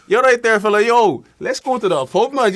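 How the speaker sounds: loud, high-pitched Irish pub shout; thick Irish accent. Acted. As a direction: holler to your mate in thick Irish. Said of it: "there's thick Irish words".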